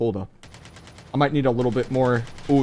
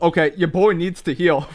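ordinary talk, nothing marked

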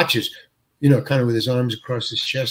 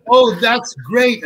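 retold yell; 7 semitones higher, 3 dB louder, slower than usual. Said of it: "And John yells".

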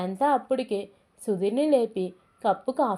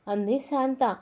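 ordinary delivery, nothing marked

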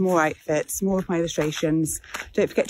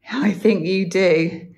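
teasing; 7 dB louder, much slower than usual. Real, not acted. Teasing dog.

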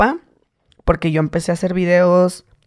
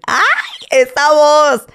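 excited fan; 8 semitones higher, much slower than usual. Retold or imitated. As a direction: shriek it like a fan who just recognized him. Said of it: "fans recognizing his voice".

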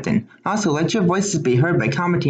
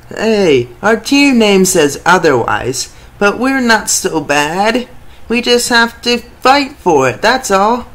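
much louder and higher, a defiant player's voice; team player pep talk; 5 semitones higher, 7 dB louder, slower than usual. Acted. Underdog player defends his team's name to viewers, loud defiant rally.

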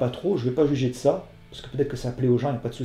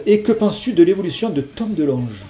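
louder, higher question-reading voice; reading a question aloud; 6 semitones higher, 7 dB louder than usual. Acted. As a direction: read the viewer's question out, raised. Reads a viewer question aloud. (lecture de question, voix plus haute et plus forte)

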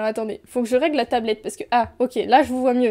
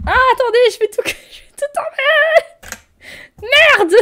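laughing panic; 11 semitones higher, 8 dB louder than usual. Real, not acted. Panicking over broken stream.

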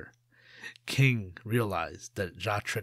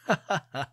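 sly trickster laugh; 7 semitones higher than usual. Acted. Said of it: "Jia laughed".

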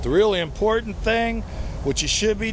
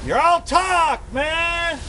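much higher shouted taunt; mock shout; 10 semitones higher, slower than usual. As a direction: yell the challenge at all rappers. Shouting at rappers.

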